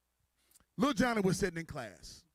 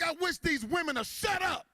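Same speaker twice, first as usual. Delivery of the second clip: high, drawn-out frustrated shout; acted fed-up kid yelling; exasperated outburst; 11 semitones higher, 3 dB louder than usual. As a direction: blow up at the interrupting classmates. Fed-up Johnny yells over classmates.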